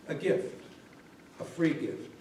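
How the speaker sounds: a whispered "A gift, a free gift."; hushed repeat. A narrator's reading. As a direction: whisper it to yourself in wonder. Bunny repeats the words to himself.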